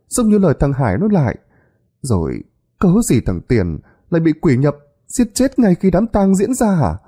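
high, pressing village woman voice; an anxious wife laying out her case, high and insistent; agitated older woman. A narrator's reading. Bà Liên voiced by narrator.

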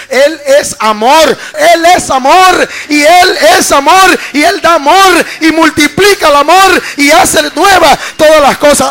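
loud, high, rousing proclamation; full-force preaching. Real, not acted. Preacher proclaiming God's love to congregation, full-force preaching. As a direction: build to a thundering praise climax.

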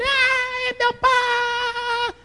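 put-on high, slow, soft childish whine; whining child. Preacher imitating the boy whining for his dad.

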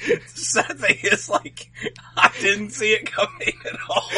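high, helpless chuckling speech; words breaking into laughs; laughing. Real, not acted. Cracking up at a joke.

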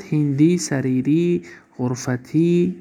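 هندی، سریری، غرفتی with final i and no n; single kasra ending. Shown as a demonstration. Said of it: "فقط یک تایی بود". (هندی، سریری، غرفتی با یک کسره، بدون نون)

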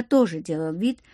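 usual speaking voice

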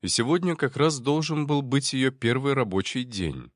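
ordinary delivery, nothing marked

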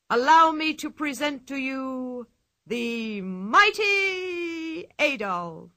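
showman's grand announcement; ringmaster. Acted. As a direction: boom it out like a circus barker. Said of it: "bowed to the audience and said".

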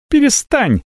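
sharp high shout. A narrator's reading. Avella shouts at the dragon to stop, a high shout. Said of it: "закричала Авелла".

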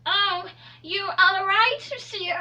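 put-on high, slow English-accented concern; English accent, worried hairdresser.

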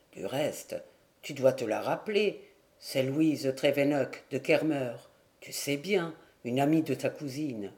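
low, calmer but still sad; a lightly voiced grieving man recovering; subdued. Grieving husband reminds his friend of his wife, subdued and low. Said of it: "Il se remettait peu à peu".

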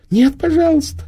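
high, pitiful begging; pitiful girl. A narrator's reading. The girl begs Prima to spare the baby, high and pitiful. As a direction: plead like a scared little girl. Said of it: "жалобно смотрела на Приму".

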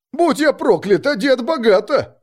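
amazed thug's exclamation; astonished. A narrator's reading. Astonished robber behind the hero.

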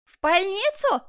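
high, loud incredulous cry; outraged yell. Acted. Prisoner refuses to be sent to hospital, yelling. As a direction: yell it back in disbelief. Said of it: "заорал Жак".

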